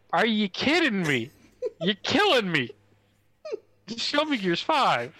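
high, swooping, pleading disbelief; exasperated plea, pitched well up; mock exasperation. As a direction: beg in hammed-up outrage. Acted exasperated plea to the developer.

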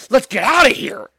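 high, loud hammed-up cry to flee; mock panicked yell. Imitating the tourist grabbing her children, loud mock panic. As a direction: shout it like fleeing a disaster. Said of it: "panicking and grabbing her children".

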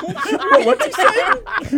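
high, loud cry through laughter; hammed-up shocked onlooker; mock bewildered audience. Grieving gala audience hearing dick jokes, loud mock bewilderment.

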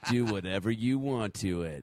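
slow and sexy. Pretend. Podcaster voicing a spoof cupcake commercial, slow and sexy. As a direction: sell it seductively, taking your time. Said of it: "Slow and sexy".

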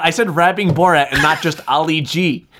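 ordinary talk, nothing marked

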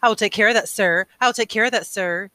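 high, loud, mocking parrot of obedience; cruel sarcastic mimicry. A narrator's reading. Boy mocking the obedient attendant, cruel sarcasm. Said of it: "Jonas mimicked in a cruel, sarcastic voice".

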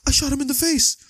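high, bright mock blurt of the admission; blurted confession. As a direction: blurt it out in a lightly acted panic. Repeating Marvin's line, laughing.

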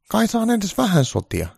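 a needy caricature of Trump; high Trump voice. Acted. Trump begging Santa for good news, high-pitched.

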